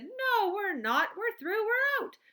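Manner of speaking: high, loud triumphant cry; triumphant Wonka. A narrator's reading. Wonka triumphantly answering Grandpa Joe. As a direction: crow with triumph.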